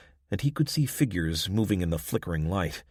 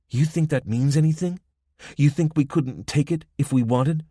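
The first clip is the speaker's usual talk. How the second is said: lightly voiced Spanish accent; 8 semitones higher, 5 dB louder than usual. A narrator's reading. Woman's face tightened, threatening.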